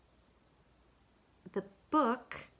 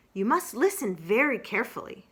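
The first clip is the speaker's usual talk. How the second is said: louder earnest instruction; Rabbit voice; 4 semitones higher, 6 dB louder than usual. Acted. Rabbit instructs Roo, louder. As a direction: insist firmly.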